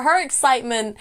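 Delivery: ordinary talk, nothing marked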